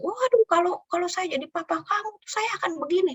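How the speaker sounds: high, exclaiming busybody voice; voicing an outsider who fuels the daughter's anger, high and exclaiming; meddling advisor. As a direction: play the gossip egging her on. Quoted advisor stirring up anger.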